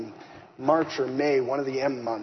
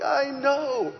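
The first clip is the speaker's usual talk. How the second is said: laughing and crying; 13 semitones higher than usual. Retold or imitated. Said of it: "We're laughing and crying on the phone".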